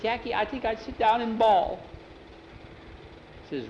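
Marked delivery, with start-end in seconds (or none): 0.0-1.8 put-on high boyish voice; lightly acted, high-voiced boy; boyish confession. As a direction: confide it in a high, boyish pitch. Boy confesses he would cry.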